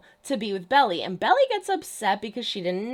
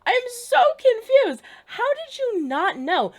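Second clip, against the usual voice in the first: much higher, louder, disbelieving cry; exasperated outburst; 11 semitones higher, 5 dB louder than usual. Real, not acted. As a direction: blurt it out in real exasperation. Exasperated reaction to TV scene.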